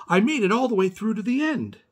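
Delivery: bursting with pride; excited. Acted. Boy telling the neighbour he won, high and excited. Boy proudly reports spelling success.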